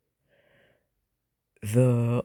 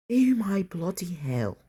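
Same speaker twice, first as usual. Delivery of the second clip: playful mock British accent, opening high and drawn out; 11 semitones higher, slower than usual. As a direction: put on a British accent for fun. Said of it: "doing a British accent for this part".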